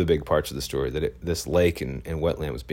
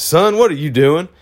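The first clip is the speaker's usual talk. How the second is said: much higher and louder, a mocking country man; country tough guy; 11 semitones higher, 9 dB louder than usual. Cleanup guy confronting the photographer, imitated country tough. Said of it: "being this country asshole kind of guy".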